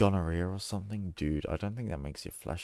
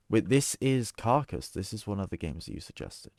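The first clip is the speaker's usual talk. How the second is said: normal talking voice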